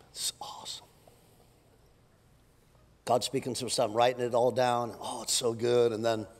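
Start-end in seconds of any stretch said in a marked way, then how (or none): 0.1-0.8 whisper. Pretend. Whispered journaling awe.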